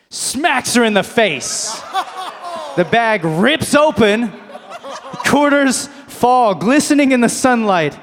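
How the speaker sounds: loud, high, drawn-out storytelling; slow-motion climax. Acted. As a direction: stretch it out dramatically, projecting hard. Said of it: "slow motion detail, I watch".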